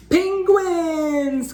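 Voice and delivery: high, loud excited cry. Acted. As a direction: burst out with joy. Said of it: "cried Splat".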